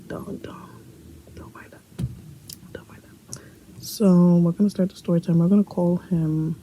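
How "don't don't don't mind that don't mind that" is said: a hushed "never mind" to the camera; whispered aside. Real, not acted. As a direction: whisper it so the sisters stay asleep. Hushed aside, others sleeping nearby.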